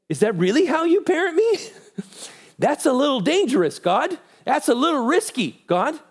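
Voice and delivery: high-pitched, nervous-sounding doubt; incredulous questioning. Acted. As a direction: act out timid disbelief, voice way up. Acted worried questioning of God's parenting.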